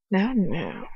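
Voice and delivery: languid, condescending drawl. A narrator's reading. Lucius to a screaming Hermione, drawling. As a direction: drawl it like a mild scolding. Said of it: "Lucius drawls".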